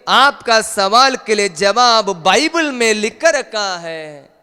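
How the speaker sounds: loud, high-pitched preaching cry; full-force preaching. Acted. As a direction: preach it out high and forceful, drawing words long. (ज़ोरदार, ऊँचे सुर में उपदेश)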